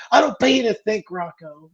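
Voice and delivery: high, loud mock boss snap; angry boss. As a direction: bark it like an impatient boss. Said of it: "When he's like".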